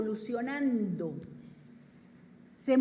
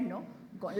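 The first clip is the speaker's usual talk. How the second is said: ordinary talk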